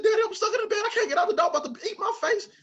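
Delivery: very high, loud mock kid plea; panicked child. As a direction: play a terrified kid begging Daddy for help. Child begging father for rescue.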